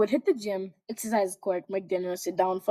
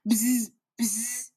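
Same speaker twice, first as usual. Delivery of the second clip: phone buzz. Retold or imitated.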